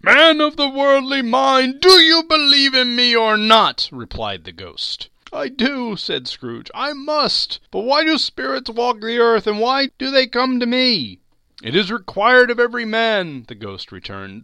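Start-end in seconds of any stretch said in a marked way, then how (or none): 0.0-3.9 high, loud, otherworldly ghost voice; ghostly demand. Acted. Marley's ghost demanding Scrooge's belief, high and loud. 5.3-11.2 put-on high, loud, pleading old man; frightened Scrooge. Scrooge questioning the ghost fearfully, high and loud. 11.6-13.5 high, loud, hollow spectre; solemn ghost. Acted. Marley's ghost lecturing Scrooge, high and loud.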